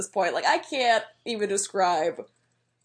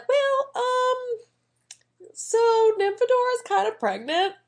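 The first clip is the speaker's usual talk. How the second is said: much higher, hesitant mock Lupin voice; sheepish Lupin; 11 semitones higher, slower than usual. Lupin sheepishly telling Harry the news, high mock voice. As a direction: squeak it out like a nervous dad-to-be.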